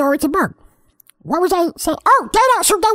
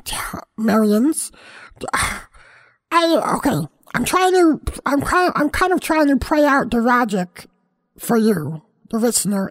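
ordinary talk, nothing marked

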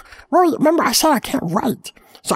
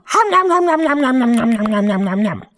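chomping "nom nom" sounds, fading lower; munch noisily, trailing off; nom nom eating noises; 5 semitones lower than usual. Acted. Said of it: "because I'm eating two whole bows".

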